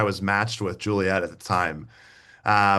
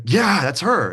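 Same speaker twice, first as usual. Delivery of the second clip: put-on excited girl voice; 9 semitones higher, 6 dB louder, slower than usual. Friend's excited retold reply.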